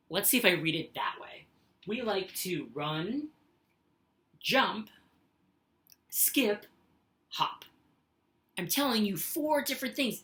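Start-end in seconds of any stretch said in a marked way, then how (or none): 1.9-7.6 slow, a clear break after "run", "jump", "skip"; pause after each item. Shown as a demonstration. Teacher modelling list pauses for the students. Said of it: "Each thing has its own pause".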